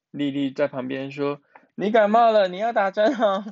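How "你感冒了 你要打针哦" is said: put-on high, loud child-character voice; little friend piping up. Lili telling sick Miaomiao about injections, piping up. Lili pipes up beside her.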